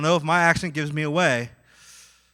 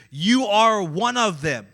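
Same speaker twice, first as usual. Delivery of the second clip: slow, loud, emphatic accusation; raised, drawn-out charge; pointed accusation; 5 semitones higher, 8 dB louder, slower than usual. Acted. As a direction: accuse deliberately, leaning hard on each word. Acting out the accusers of Peter.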